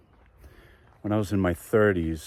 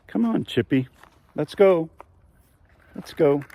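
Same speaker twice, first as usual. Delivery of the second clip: calling the dog; 8 semitones higher, 5 dB louder than usual. Real, not acted.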